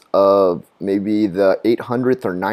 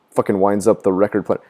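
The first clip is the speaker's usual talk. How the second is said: ordinary talk, nothing marked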